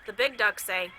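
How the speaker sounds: put-on gentle read-aloud to a child; calm storybook narration; story reading. A narrator's reading. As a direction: read it like a picture book at bedtime. Reads a picture book aloud.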